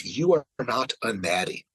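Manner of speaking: ordinary talk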